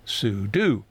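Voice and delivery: slow, spelled-out SOO-DOO for sudo. Shown as a demonstration. Concluding the super user argument, sudo said slowly as soo-doo. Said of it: "short for super user do".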